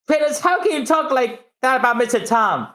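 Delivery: high, outraged question; indignant apprentice. Acted.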